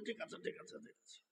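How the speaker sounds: hushed, voiceless pleading ok; whispered pleading. Retold or imitated. Cleric clinging to hands and feet, whispering to calm them. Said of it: "হাত পা জড়িয়ে ধরে বলছে".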